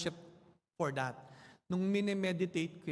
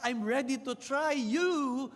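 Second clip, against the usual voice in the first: put-on eager boy; 6 semitones higher, 4 dB louder, slower than usual. Boy launches his boat.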